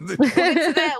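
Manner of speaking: laughing through the words. Real, not acted. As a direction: let a laugh break through. Laughing while deferring topic.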